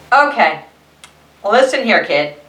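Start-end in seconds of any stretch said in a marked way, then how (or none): none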